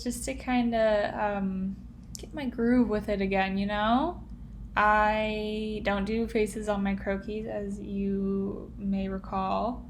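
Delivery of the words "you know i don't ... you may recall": normal talking voice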